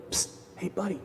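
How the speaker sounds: whispered call. Acted. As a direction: hiss it like a hidden snake calling out. Snake calls to passing man.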